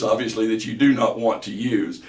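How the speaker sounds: usual speaking voice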